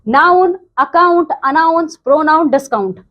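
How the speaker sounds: teacher modelling word list; reading words aloud. Shown as a demonstration. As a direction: model each word for pupils to repeat. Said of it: "now read these own sound words".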